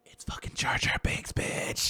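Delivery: loud, voiceless whispered reveal; forceful stage whisper. Real, not acted. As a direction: hiss out the big reveal with force. Big reveal of theory.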